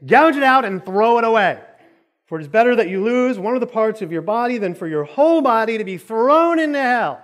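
loud, high preaching delivery; forceful scripture reading. Acted. Preacher reading the warning about hell, forceful scripture reading.

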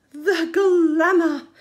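slow, breathless wonder; awed gasp. Acted. Crab marvelling at his tower of plunder, awed gasp. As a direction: gasp at the sparkle. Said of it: "gasped Fab".

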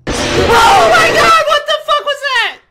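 a real scream of fright; terrified scream. Real, not acted. Jump scare in the game.

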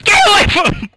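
high, loud yell; shouting. Real, not acted. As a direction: yell out a warning. Shouting during chase.

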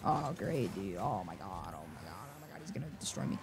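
very quiet, low grumble to oneself; resigned dread. Real, not acted. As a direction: say it under the breath, expecting defeat. Sees he will be beaten.

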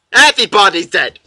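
high, loud outcry, falling at the end; loud exclamation. Real, not acted. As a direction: shout it out in dismay. Exclaims over game's body count.

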